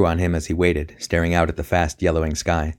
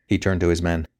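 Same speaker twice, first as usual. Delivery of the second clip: ordinary delivery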